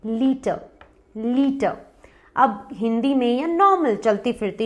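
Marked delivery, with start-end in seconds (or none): 0.1-1.8 लीटर with long "ee", silent R; correct pronunciation. Shown as a demonstration. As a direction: say लीटर as "lee-tuh". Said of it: "इसका correct pronunciation है लीटर".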